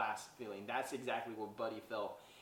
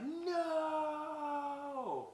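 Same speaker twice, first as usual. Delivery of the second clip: high, stretched-out No in anguish; anguished cry. Retold or imitated. Buddy hearing his dad is naughty, a high wailing No. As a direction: wail the word like a heart breaking. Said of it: "Buddy just loses his mind".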